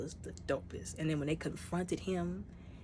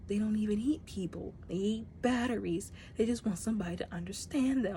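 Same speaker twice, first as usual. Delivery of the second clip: Mantis whisper; 5 semitones higher than usual. Retold or imitated. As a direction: whisper it gently to the creatures. Voicing Mantis gently.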